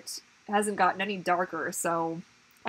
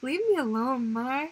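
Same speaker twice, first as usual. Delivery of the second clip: put-on baby's voice; 5 semitones higher, slower than usual. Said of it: "He's like".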